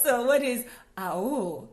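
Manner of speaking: slow, laughing question. Real, not acted. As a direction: ask through tears of laughter. Asks while laughing.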